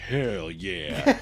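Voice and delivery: imitated cool dad. Imitating the dad's low reply.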